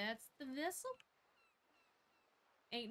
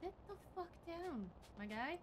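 sharp, high mock command; pitched-up scolding order; angry; 7 semitones higher than usual. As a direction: snap at him to sit down. Snapping at an unruly character.

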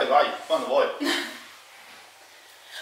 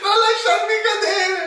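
laughing plea; 12 semitones higher than usual. Real, not acted. Begging while balanced, laughing.

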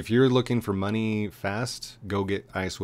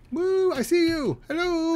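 much higher, louder squeaky hello; squeaky inside joke greeting; 5 dB louder than usual. Acted. Co-host greets the hidden figure in a squeaky voice. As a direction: squeal it like a silly character.

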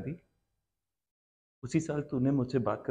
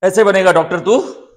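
very loud, high angry yell; furious shout; 6 semitones higher, 19 dB louder than usual. Acted. Parent raging at Adi over becoming a doctor, furious shout. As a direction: explode at the child at full volume.

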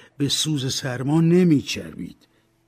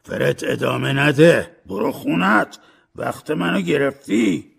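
loud, higher angry dismissal; 3 semitones higher, 8 dB louder than usual. A narrator's reading. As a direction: cut him off in a temper. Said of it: "اسکروچ عصبانی شد".

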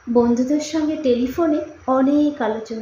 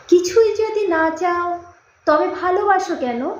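higher-pitched, puzzled challenge; insistent questioning; 5 semitones higher than usual. A narrator's reading. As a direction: press him for the reason in a raised voice. Girl presses boy for reason.

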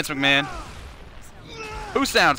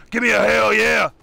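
loud hammed-up hell yeah; mock Stone Cold shout; 5 semitones higher, 5 dB louder than usual. Streamer closing the donation as Stone Cold, loudly. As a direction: yell it like a wrestling catchphrase. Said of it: "I can't do a Stone Cold".

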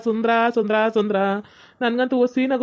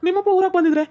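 put-on higher-pitched voice for the young suitor; young man's voice; 6 semitones higher than usual. [name] answers Kavya's phone call, higher puzzled young man voice.

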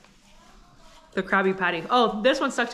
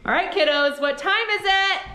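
excited call; 9 semitones higher than usual. Real, not acted. Mom calls kids to taste test.

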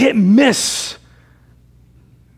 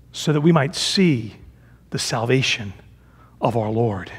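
hushed reverence; 10 semitones lower, 6 dB quieter than usual. Real, not acted. Preacher drops to hushed close.

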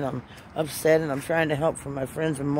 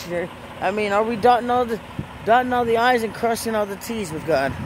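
preaching; 6 semitones higher than usual. Acted. Preaching to her viewers about getting right with God, fervent and raised. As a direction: press the viewers hard like a sermon.

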